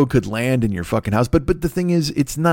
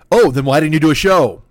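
louder, higher indignant demand; mock accusation; 5 semitones higher, 6 dB louder than usual. Imagined follow-up email from a listener, mock indignant outburst. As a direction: burst out as an accuser.

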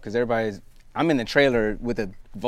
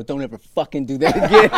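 louder mock threat; imitated angry passerby; 3 semitones higher, 8 dB louder than usual. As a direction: imitate a stranger telling you off. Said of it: "somebody go".

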